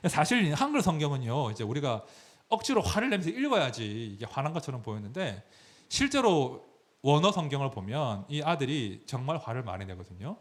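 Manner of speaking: ordinary delivery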